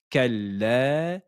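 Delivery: measured tajweed chant of the verse; Quran recitation. Shown as a demonstration. Teacher reciting the verse for students, chanted. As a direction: recite it clearly for students to copy. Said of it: "Je commence et vous répétez après moi".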